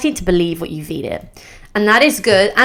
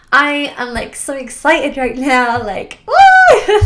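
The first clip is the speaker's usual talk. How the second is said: real excitement; 6 semitones higher, slower than usual. Real, not acted. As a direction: burst out with a giddy cheer. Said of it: "see how that affects your brain".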